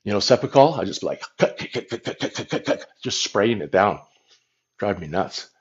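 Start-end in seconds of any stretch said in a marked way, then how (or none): 1.4-2.9 spray noise. Retold or imitated. Imitating throat spray being pumped.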